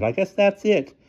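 high ladylike falsetto; agreeing headmistress. A narrator's reading. Headmistress agrees with the driver, high voice.

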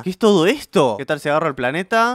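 ordinary delivery

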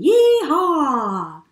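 high, loud whooping cowboy cry; cowboy whoop for the children; cowboy yee-haw. Acted. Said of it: "He's saying".

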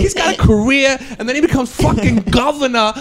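Bill Burr Boston impression. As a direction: riff like an incredulous Boston stand-up. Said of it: "That was my Bill Burr".

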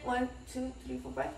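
quietly counting while holding breath; counting held breath. Shown as a demonstration. Demonstrating breathing exercise to viewers, soft counting. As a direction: count it out softly, holding air. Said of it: "And then hold it".

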